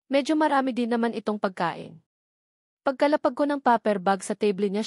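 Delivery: ordinary delivery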